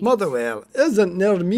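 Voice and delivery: lightly voiced scolding friend; a touch of an indignant Cat; mock telling off. Reading Cat's chat reply aloud, mock indignant telling off. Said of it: "I love how she's telling you off".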